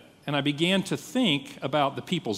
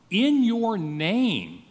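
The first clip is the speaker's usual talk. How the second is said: "In your name" higher and very slow, each word stretched; stress on IN YOUR NAME; 5 semitones higher, much slower than usual. Shown as a demonstration. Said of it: "give you the sense of the emphasis".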